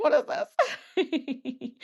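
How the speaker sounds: laughing through the question; mock-baffled, breaking into a laugh; amused disbelief. Laughing at her own recording.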